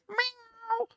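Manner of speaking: high, squeaky meowing like a kitten; cat impression. Shown as a demonstration. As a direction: meow like a little cat. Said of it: "my cat impression".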